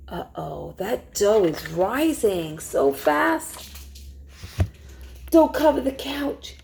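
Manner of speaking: plain reading voice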